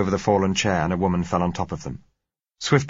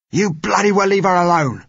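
high, very loud roar; angry roar; 13 semitones higher, 9 dB louder than usual. A narrator's reading. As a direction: roar it to warn them off. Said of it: "roared the man who held her".

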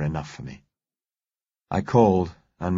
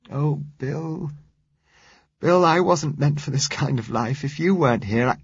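higher, crumpling voice on the edge of tears; Josella near tears; 7 semitones higher than usual. A narrator's reading. Josella breaking down to Bill, near tears. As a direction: let her words break as she gives way. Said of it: "It puckered".

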